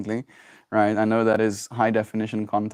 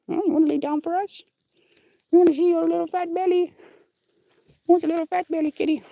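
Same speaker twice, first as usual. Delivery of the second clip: much higher cutesy cooing; faster than usual. Real, not acted. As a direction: coo at the cat in a tiny high voice. Coaxing cat to lie down.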